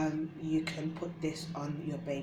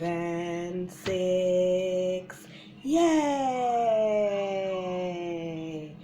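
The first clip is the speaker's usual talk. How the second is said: put-on teaching a child to count; 3 semitones higher, 5 dB louder, much slower than usual. Shown as a demonstration. Counting for babies.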